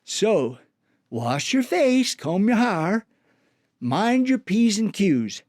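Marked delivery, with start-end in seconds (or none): none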